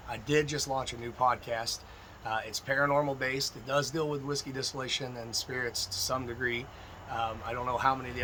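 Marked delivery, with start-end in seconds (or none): none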